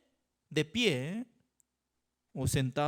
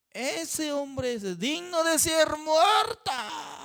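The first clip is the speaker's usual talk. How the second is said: slow, high, declaring the sentence; David's verdict; 9 semitones higher, slower than usual. Retold or imitated. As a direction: pronounce the death verdict slowly and high. David condemning the rich man.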